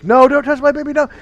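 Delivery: mock panicked mom, high and loud; alarmed mother. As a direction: imitate a mother shrieking in protest. Acted protest of alarmed mother.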